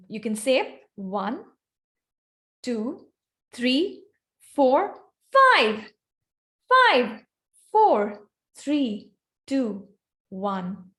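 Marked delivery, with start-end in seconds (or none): 0.9-5.9 pitch-ladder count, rising; climbing pitch. Shown as a demonstration. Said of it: "shrill you can go and how deep". 6.7-10.9 pitch-ladder count, falling; descending pitch. Shown as a demonstration. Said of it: "how deep you can go".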